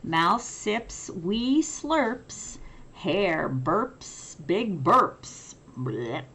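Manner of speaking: lively picture-book read-aloud; reading to children. A narrator's reading. Teacher reading a picture book to children, lively. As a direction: read it like bedtime for little ones.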